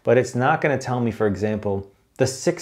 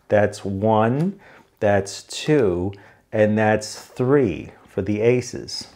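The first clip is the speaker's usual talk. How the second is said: slow, spaced-out counting; one step at a time, with gaps between; deliberately slow; much slower than usual. Shown as a demonstration. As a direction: count it off very slowly. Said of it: "You gotta talk slow sometimes".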